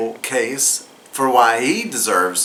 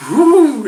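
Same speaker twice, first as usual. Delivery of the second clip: loud, high whooping sound; loud high whoo; 14 semitones higher, 6 dB louder than usual. Retold or imitated. Magic sendoff noise.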